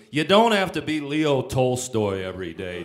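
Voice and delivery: laughing while retelling the scolding; laughing shout. Retold or imitated. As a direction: bellow the advice, half laughing. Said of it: "He goes".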